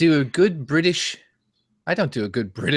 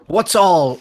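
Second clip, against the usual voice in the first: loud, higher video-host greeting; pitched-up YouTuber impression; eight-bit Eric; 6 semitones higher, 6 dB louder than usual. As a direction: launch into a mock video intro. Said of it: "My version of eight-bit Eric is like".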